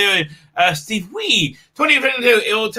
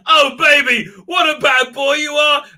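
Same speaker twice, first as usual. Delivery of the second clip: higher, louder hammed-up come-on; hammed-up seductive line, higher and louder; mock sultry Laura Dern; 8 semitones higher, 5 dB louder than usual. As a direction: play the purring lover, loud and over the top. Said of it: "she goes".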